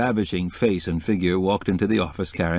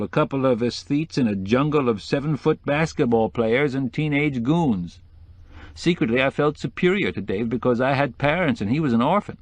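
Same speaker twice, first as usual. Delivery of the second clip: put-on higher-pitched decorator telling an old grievance; resentful decorator; 5 semitones higher than usual. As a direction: let the old rivalry rankle as you recount it.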